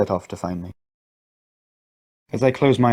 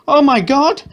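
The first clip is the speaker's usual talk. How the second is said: much higher, much louder cry; shouted; 7 dB louder, slower than usual. A narrator's reading. Mother after the blast, much louder higher cry. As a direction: horror at the explosion. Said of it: "she shouted".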